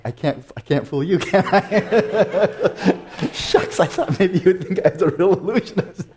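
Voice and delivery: laughing through the words, pitch up; giggling admission of a failed trick; amused, mock-disappointed laugh. Real, not acted. Laughing at his own failed trick.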